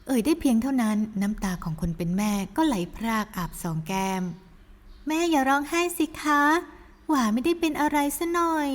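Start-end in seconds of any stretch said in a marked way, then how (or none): 4.6-8.7 put-on higher, slow girlish voice; sweet daughter. Daughter reassures her mother, higher and slow. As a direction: coax mother gently, high.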